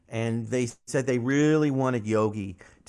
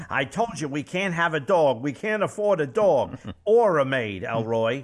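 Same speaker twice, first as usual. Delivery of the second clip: George Jetson voice; 3 semitones higher, 4 dB louder than usual. Acted. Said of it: "who was voice of George Jetson".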